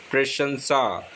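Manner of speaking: ordinary talk, nothing marked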